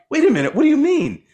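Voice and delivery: high-pitched imitation of indignant insistence; chip on the shoulder. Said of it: "they kinda have this little chip".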